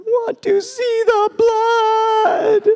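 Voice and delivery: very high, loud, drawn-out wail; hammed-up bawling toddler, stretched way out; crying child. Son who fell and didn't bleed, wailing in disappointment. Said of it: "he started crying".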